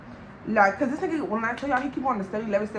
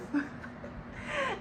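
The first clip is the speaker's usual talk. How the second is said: high, silent breathy wheeze of a laugh; wheezy laugh; 11 dB quieter than usual. Real, not acted.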